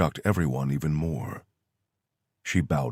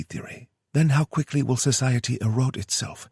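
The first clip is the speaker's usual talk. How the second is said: put-on higher, kind young woman's voice; kindly Kyoto; 8 semitones higher than usual. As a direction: speak gently, like reassuring a friend. Kyoto explains the secret war.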